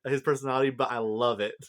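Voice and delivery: usual speaking voice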